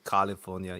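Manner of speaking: California with an open "ah" in the first syllable; Arnold's pronunciation. Retold or imitated. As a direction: softly mimic an Austrian "Cah-lifornia". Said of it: "pronounce the word California".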